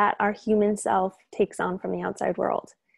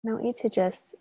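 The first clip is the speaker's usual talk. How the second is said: calm, unhurried meditation guide with long pauses; soothing, even delivery, leaving space between phrases; guided meditation. Real, not acted. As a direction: gently lead listeners through breath and release.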